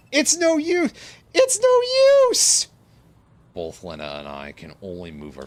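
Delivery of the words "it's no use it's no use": high, loud, drawn-out wail; despairing shout. Acted. Trapped character crying out that it is hopeless, loud wail. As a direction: scream it in despair.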